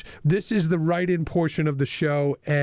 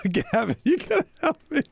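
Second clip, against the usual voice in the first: strained, much higher, fast, choking plea; squeaky voice while choking on water; choking cough; 8 semitones higher than usual. Real, not acted. Choking on water, pleading for help.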